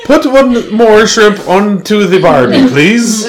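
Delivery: high, slow mock Aussie accent; hammed-up Australian, stretched out and squeaky high. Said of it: "Australian accent".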